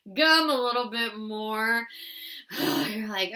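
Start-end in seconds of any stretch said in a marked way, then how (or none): 1.9-2.8 a long breathy sigh of relief; relieved sigh. Acted. Driver after the near miss, sighing with relief. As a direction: let the air out, relieved it's over.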